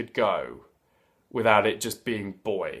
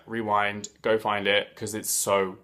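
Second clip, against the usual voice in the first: normal talking voice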